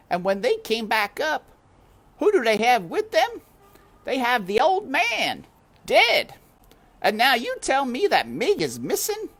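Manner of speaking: high, loud scornful housekeeper; grim maid Louise. A narrator's reading. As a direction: snap it like a tough housekeeper. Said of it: "said Louise".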